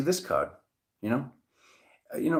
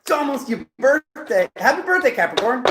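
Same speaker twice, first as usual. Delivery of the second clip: high, loud birthday cheer; bubbly high-pitched well-wishing; excited birthday greeting; 12 semitones higher, 5 dB louder than usual. Real, not acted. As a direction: celebrate it, pitched way up.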